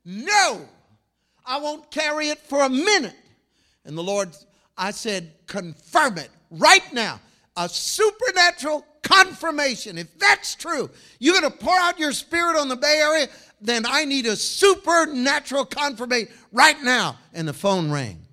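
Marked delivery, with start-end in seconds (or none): 0.0-3.2 high, raised-voice protest; acted stubborn outburst; defiant refusal. Acting out refusal of the promise. 5.5-17.2 high, insistent challenge to God; acted urgent plea, hammering each phrase; loud demand.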